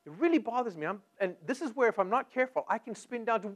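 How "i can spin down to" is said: normal talking voice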